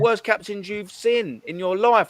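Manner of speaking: high, mock-indignant fan rant; exasperated rant. Fan retelling scolding an older supporter, high mock outrage.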